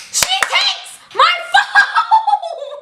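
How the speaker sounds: high drawn-out shriek of disbelief; outraged scream. Retold or imitated. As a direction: scream it slowly, laughing, way up high. Replays outrage at phone taken.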